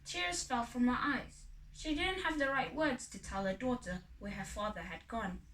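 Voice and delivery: ordinary delivery, nothing marked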